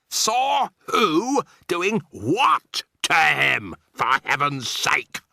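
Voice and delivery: Mr Jenkins barks out his exasperated question, high and loud; an irate hotel guest losing patience; exasperated shout. A narrator's reading. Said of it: "shouted Mister Jenkins".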